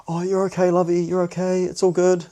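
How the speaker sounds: cooing strippers. Retold or imitated. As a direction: coo at him to keep him calm. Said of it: "the girls in the room are like".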